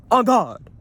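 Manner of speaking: high, loud yelp; sudden high exclamation of alarm; startled cry. Real, not acted.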